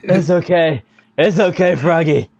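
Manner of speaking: calm him down gently; soothing. Acted. Comforting upset friend.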